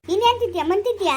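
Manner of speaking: high, loud exasperated scold; aunt scolding the girl over her food; angry scolding. Acted. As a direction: snap at her in disbelief.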